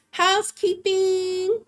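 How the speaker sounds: squeaky high greeting voice; high-pitched greeting. Retold or imitated. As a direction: chirp it high and drawn out. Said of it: "she'll be like".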